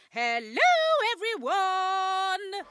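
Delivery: hello, then a long drawn-out everyone; stretched greeting. Shown as a demonstration. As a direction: drag out everyone to get them shouting back. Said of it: "I said".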